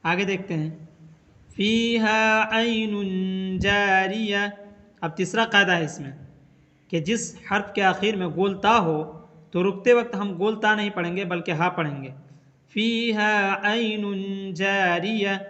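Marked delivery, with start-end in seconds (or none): 1.5-4.5 slow, melodic tajweed chant; धीमी तिलावत की आवाज़; Quran recitation. Shown as a demonstration. Recites Quran verse as example. 12.7-15.4 slow, melodic tajweed chant; धीमी तिलावत की आवाज़; Quran recitation. Shown as a demonstration. Recites Quran verse as example.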